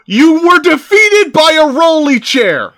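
high, loud mocking jeer; mocking taunt. Real, not acted. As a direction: taunt him, dragging out defeated. Mocking the defeated villain.

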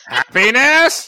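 very high, loud, slow clown voice; horrible clown voice. Acted. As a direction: drag it out like a creepy mini golf clown. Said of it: "you sound like the clown at the end of a".

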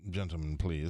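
low, proper English plea; English accent. Retold or imitated. English secretary to joking golfers, low proper English accent. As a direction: appeal to them in a reserved English accent. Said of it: "This guy was English".

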